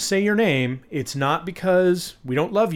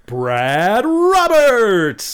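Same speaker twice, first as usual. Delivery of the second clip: announcer; 8 semitones higher, 10 dB louder, much slower than usual. Acted. Dramatic patron name roll call.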